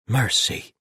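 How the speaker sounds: landlady sees three armored paladins, startled; startled exclamation. A narrator's reading. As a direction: blurt it out in shock.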